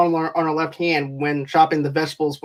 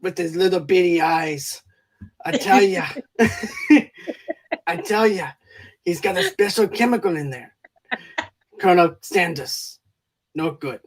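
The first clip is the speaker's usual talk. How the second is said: mock Scottish accent. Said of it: "he goes".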